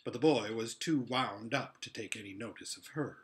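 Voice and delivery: plain reading voice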